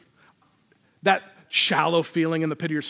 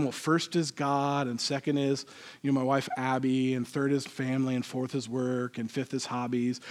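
hushed pious answer; 5 semitones lower, 7 dB quieter than usual. Acted.

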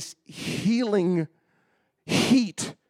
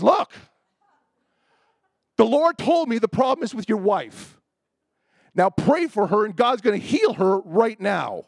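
higher, louder, stern insistence; mean Bob Jones; 7 semitones higher, 6 dB louder than usual. Retold or imitated. Prophet ordering doubtful husband to pray, mock stern. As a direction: lay down the law, imitating an exasperated prophet. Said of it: "nice Bob Jones turned to mean Bob Jones".

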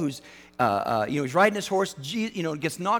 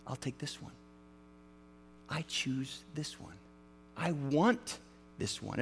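very soft and low, hushed; tender voice of God; 4 semitones lower, 11 dB quieter than usual. Retold or imitated. God picking you up, softly and tenderly. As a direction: choose them gently, almost whispering.

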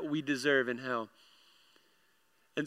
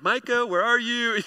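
higher, mock-panicked call; frantic calling out; 7 semitones higher, 4 dB louder than usual. Overwhelmed dad calls for Micah, frantically. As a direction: call out for help in comic desperation. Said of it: "I'm frantically trying to put all this together".

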